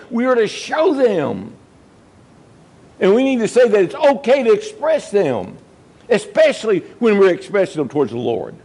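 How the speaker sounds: high, rising preacher's appeal; preacher pleading with the congregation to keep their first love, fervent; fervent preaching. Acted. Preacher urges showing emotion.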